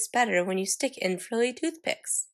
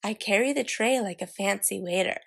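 plain reading voice

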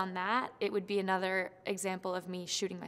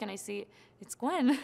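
ordinary talk

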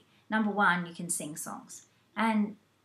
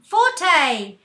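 called out loud and high; 8 semitones higher, 16 dB louder than usual. Shown as a demonstration. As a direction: announce it with a shout. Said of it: "I've added in louds and softs".

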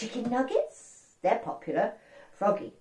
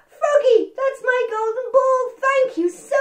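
much higher, brighter-pitched little princess; 9 dB louder than usual. Acted. Princess thanking the frog for her ball, high and pleased. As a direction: squeak it out like a delighted little girl.

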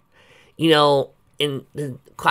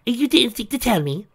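indignant; 13 semitones higher, 5 dB louder than usual. Acted. Indignant question.

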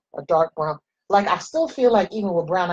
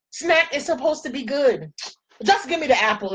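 normal talking voice

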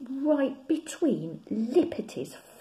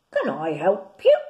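low croaky little frog voice, louder; croaking frog; 3 semitones lower, 11 dB louder than usual. Acted. Small frog croaks to the bear at his foot. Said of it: "croaked a voice".

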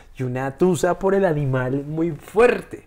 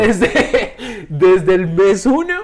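louder, slow, laughing through the words; 6 semitones higher, 9 dB louder, much slower than usual. Real, not acted. Telling a friend his puppy training plan, laughing. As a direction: laugh at your own ambitious plan.

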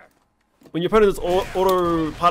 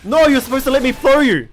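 loud, high-pitched cry of protest; real indignant protest; 9 semitones higher, 7 dB louder than usual. Real, not acted. Player objecting to an opponent who won't be thrown, indignant shout. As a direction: call out in exasperation at the game.

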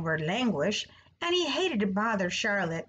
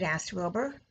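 usual speaking voice

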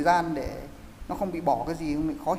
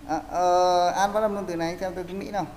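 ordinary talk, nothing marked

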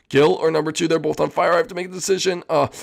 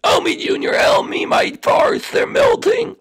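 very high, loud panicked cry; frantic high shout; 5 dB louder, slower than usual. Acted. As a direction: shout that the cars are melting. Number two's cars melting.

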